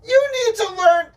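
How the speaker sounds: high, loud sing-song mimicry of "You need to learn"; mocking echo. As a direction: throw the jab right back, teasing. Mocking the co-host's jab back.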